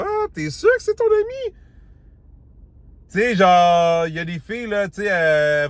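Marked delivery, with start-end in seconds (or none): 0.1-1.6 high-pitched, mock-doubting "t'es sûr"; teasing doubtful tone. Explaining a woman's tone, teasingly doubtful. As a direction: sound like you don't believe it's just a friend. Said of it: "avec une intonation de voix".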